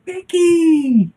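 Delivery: real delighted surprise at spotting a friend; sudden excited shout of a name; surprised gasp. Real, not acted. Sees viewers watching.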